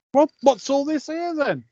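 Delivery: high, startled tramp voice; surprised tramp. Acted. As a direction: jump up in mock alarm.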